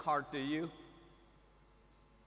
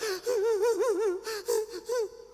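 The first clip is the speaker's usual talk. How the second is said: a high, loud, broken sob that turns into a laugh; choked sob-laugh; 7 dB louder than usual. Retold or imitated. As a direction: overcome at the card, let it burst out. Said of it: "I start going like".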